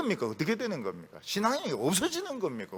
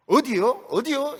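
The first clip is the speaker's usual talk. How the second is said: higher-pitched mimicked Jeolla accent; Jeolla dialect; 6 semitones higher, 5 dB louder, slower than usual. Shown as a demonstration. Preacher showing how Jeolla people talk, higher Jeolla lilt. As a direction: imitate a Jeolla speaker, pitched up and punchy. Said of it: "이건 다 전라도 사람들이 하는 거죠".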